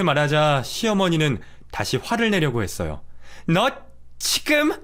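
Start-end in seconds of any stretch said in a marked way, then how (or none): 3.5-4.7 put-on much higher, threatening old woman; high, sharp warning; mother-in-law. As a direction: start to lash out at her. Mother-in-law about to explode.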